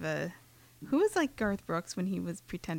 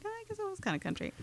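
mock realization; 12 semitones higher, 8 dB quieter, much faster than usual. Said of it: "and I'm like".

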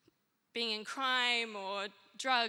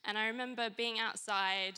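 usual speaking voice

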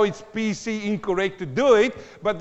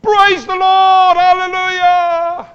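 very high, loud, drawn-out cheer; mock newborn praise; 12 semitones higher, 15 dB louder, slower than usual. Preacher imagining a baby praising at birth, jubilant shout. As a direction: belt out slow, exultant praise as a pretend newborn. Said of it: "come out of the womb and they go".

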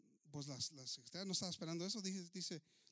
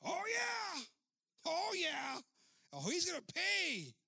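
high, loud hammed-up gloat; gloating revenge; 10 semitones higher, 6 dB louder, slower than usual. Pastor gloating to his secretary about revenge. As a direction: crow it, relishing the payback.

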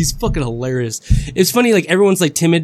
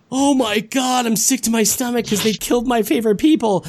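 mock dismay; 6 semitones higher than usual. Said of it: "Game of Thrones shock moment are like".

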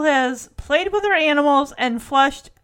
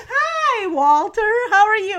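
forced cheery greeting; 8 semitones higher than usual. Retold or imitated. Jesse forced to chat with Walter, fake cheer. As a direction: put on a bright phone hello.

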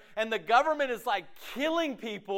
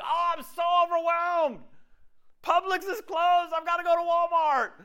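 overdramatic; 10 semitones higher than usual. Acted. Preacher mocking shoppers whose store is closed, overdramatic. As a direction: wail it like a hammed-up catastrophe. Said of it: "we use words that are so dramatic".

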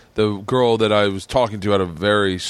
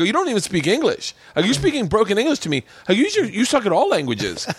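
high-pitched retold argument; incredulous, high put-down; exasperated comeback; 10 semitones higher than usual. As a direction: fire back in mock exasperation, pitch raised. Retold quarrel with heckler.

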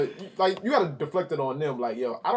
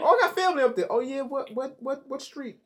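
mock nosy questioner; 10 semitones higher than usual. Mocking people quizzing his hometown.

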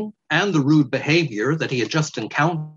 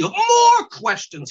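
much higher, slow exasperated scream; screaming weasel; 3 dB louder, much slower than usual. Acted. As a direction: scream it in disbelief. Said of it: "screamed the weasel".

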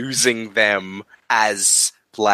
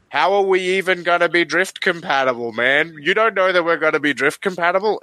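higher-pitched retold protest; raised, incredulous imitation; mock challenge; 7 semitones higher than usual. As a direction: voice a doubting pilot challenging his partner. Acting out film character challenging Stacker.

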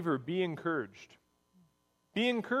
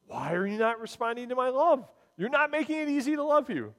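high, pleading exasperation; mock exasperation, voice climbing high; exasperated plea; 9 semitones higher than usual. A parent pleading with kids who won't respond, exasperated and high.

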